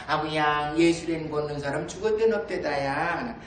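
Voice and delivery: put-on low Jeju dialect, old women chatting; Jeju grandmother dialect. Two grandmothers weeding and chatting, in Jeju dialect. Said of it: "할망 둘이가 막 검질 매다가 합니다".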